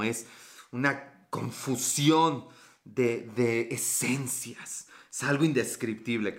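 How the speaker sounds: slow, low, hammed-up sultry; mock seductive. Teasing Carla, mock sultry and slow. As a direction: stretch it out like a cheesy lover.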